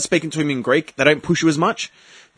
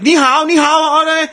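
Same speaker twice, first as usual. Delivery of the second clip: mock sing-song greeting; 10 semitones higher, 4 dB louder than usual. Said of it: "he's like".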